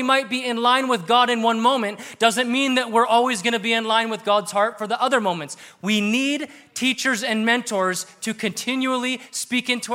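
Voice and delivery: loud, high, fervent sermon voice; preaching. Acted. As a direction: drive the point home from the pulpit. Preaching at full force.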